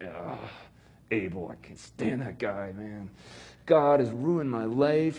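low, muttered resentful grumble with a slow end; sulky angry Cain. Retold or imitated. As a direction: grumble like a jealous brother. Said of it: "angry voice".

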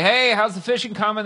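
high, loud friendly hail; calling out. Retold or imitated. Man on shore hails fishermen, calling out. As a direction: call across the water.

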